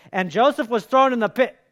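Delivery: loud, high imitation of a preacher regaining his voice; voice suddenly restored. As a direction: belt it out like the voice just came back. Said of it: "all of a sudden he was talking like this".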